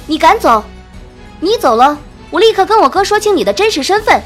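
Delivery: loud, high, furious threat; angry. A narrator's reading. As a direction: threaten in anger, speeding up at the end. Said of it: "夏娜愤怒道".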